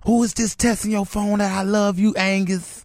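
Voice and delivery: a hint of a suspicious wife; angry wife. Wife confronting him in story.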